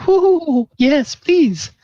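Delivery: very high, swooning falsetto; hammed-up tipsy lady's coo; delighted flirtatious lady. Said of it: "And she said".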